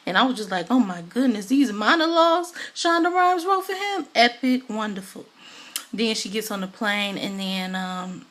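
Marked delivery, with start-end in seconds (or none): 0.7-5.3 high, loud burst of admiration; thrilled fan exclamation; gushing. Real, not acted. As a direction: rave about it, pitch soaring. Gushing over the show's monologues.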